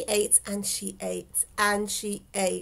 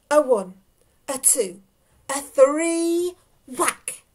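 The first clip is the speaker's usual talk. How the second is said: rising count-in, long high THREE, then whack; building count to a whack; 5 semitones higher, 6 dB louder, slower than usual. A narrator's reading. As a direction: draw out the count, pitch climbing, into the whack.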